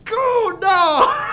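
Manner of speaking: shocked, loud "oh no"; surprised shout. Real, not acted. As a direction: yelp in dismay. Sudden mishap.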